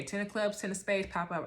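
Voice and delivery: ordinary talk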